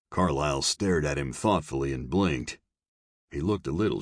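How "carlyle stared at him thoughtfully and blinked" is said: ordinary delivery, nothing marked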